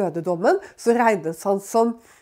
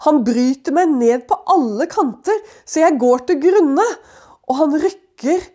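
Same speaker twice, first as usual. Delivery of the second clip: higher, louder anguished plea read from scripture; Job's lament; 3 semitones higher, 4 dB louder than usual. Acted. Preacher voicing Job accusing his friends, anguished and raised.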